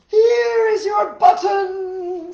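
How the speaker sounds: high, loud, drawn-out cry; loud call. Acted. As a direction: shout it out slowly to Toad. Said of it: "said Frog".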